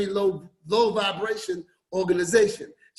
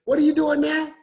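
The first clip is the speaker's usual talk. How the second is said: higher, fast insistent questions; prying coworker; 6 semitones higher, faster than usual. Retold or imitated. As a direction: push for details. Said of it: "she kept saying".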